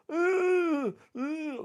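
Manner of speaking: high wailing ooh; mock moaning. Teller mimicking man in wheelchair, high wail. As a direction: imitate a man's drawn-out moan.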